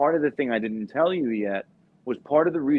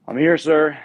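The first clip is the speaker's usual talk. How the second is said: higher, loud recruit announcement; imitating a recruit reporting, raised and loud; 4 semitones higher, 8 dB louder than usual. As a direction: announce yourself loudly to a superior. Kid reports in at office.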